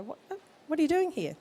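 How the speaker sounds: a light tut at a new mum; mock exasperated shock. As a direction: midwife scolding a mum with a newborn in the supermarket. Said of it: "and you go".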